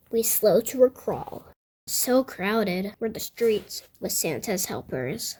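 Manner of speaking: usual speaking voice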